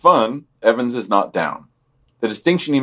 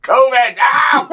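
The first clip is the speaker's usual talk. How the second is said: high and loud through laughter; laughing shout; 13 semitones higher, 5 dB louder, slower than usual. Real, not acted. Cohost trying to read on, shouting through laughter. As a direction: shout it out while still laughing.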